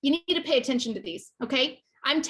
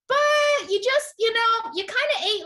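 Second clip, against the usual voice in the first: mocking inner voice; 8 semitones higher than usual. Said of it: "your brain is gonna say".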